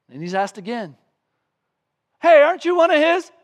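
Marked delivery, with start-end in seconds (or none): 2.2-3.3 high, loud accusing question; accusing call. Retold or imitated. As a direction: shout it at him across the courtyard. Second accuser questions Peter.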